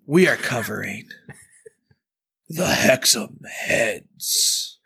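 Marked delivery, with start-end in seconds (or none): 2.5-4.8 slow, whispered eerie title; mock spooky. Host naming the topic in a spooky whisper. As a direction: draw out the title like a ghost story. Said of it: "It doesn't really sound scary".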